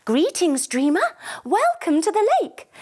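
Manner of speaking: a hint of a gentle magical unicorn; lightly voiced unicorn, rising on welcome. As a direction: greet the child like a kind unicorn. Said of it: "just like Twilight, the unicorn".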